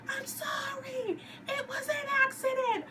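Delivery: high, slow whiny pleading; mock pleading. Leader lady mocked as she pleads, high whiny. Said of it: "the lady in charge is like".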